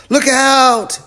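very high, full shout; yelled warning. A narrator's reading. Chip warns of a crash, high frantic yell. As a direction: scream out a warning of danger. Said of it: "yelled Chip".

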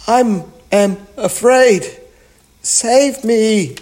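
high, loud cry for help; hammed-up storybook terror; frightened plea. A narrator's reading. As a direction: beg to be saved, voice pitched right up. Frightened knight begs for rescue.

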